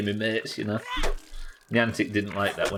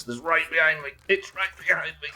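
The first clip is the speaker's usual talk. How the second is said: being chased; 7 semitones higher than usual. Real, not acted. Monster right behind him.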